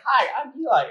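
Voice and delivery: imitating a young boy saying hi; prepubescent boy voice. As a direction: chirp it like a little kid before his voice breaks. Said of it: "in his, like, prepubescent voice".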